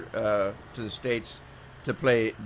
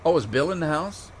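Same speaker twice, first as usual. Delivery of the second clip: normal talking voice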